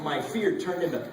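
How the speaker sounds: ordinary delivery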